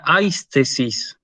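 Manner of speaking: aistesis drawn out slowly, stress on the A of AIS; first-vowel stress. Shown as a demonstration. Teacher reads next word, diphthong stressed on first vowel. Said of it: "se pronuncia en el primero".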